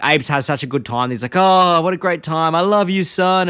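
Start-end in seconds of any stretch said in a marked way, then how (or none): 1.4-3.5 slow, lightly voiced grandpa; a hint of Abe, tender and slow; fond old dad. Voicing Abe.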